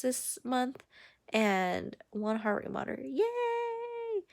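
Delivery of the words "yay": high squealed little cheer; excited yay. Real, not acted. Vlogger cheering to viewers about her sales, excited. Celebrating sold memberships.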